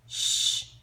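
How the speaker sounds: unvoiced shh; hushing shh. Finny hushing Crag near the bushes, shh. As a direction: hush them with a shh. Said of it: "Finny said".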